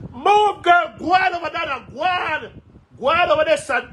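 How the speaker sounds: yelling at a critic to get away; angry shout. Real, not acted. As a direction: drive her off with a yell.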